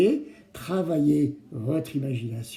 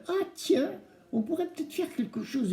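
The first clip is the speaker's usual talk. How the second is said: surprised discovery; 12 semitones higher, 4 dB quieter than usual. Retold or imitated. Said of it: "se dire".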